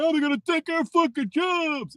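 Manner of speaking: high, mocking whine; mocking complainers. Mocking Americans who panic about their jobs, high sneering whine. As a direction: parody them in a high put-on voice. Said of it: "motherfuckers run around screaming".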